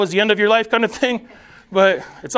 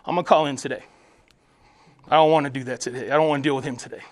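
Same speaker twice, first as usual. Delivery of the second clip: lazy reluctant enemy; 8 semitones lower, 5 dB quieter, faster than usual. Retold or imitated. The enemy calling in on his assignment, lower and softer, lazy. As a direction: lower and softer, a demon dodging work. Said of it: "he goes".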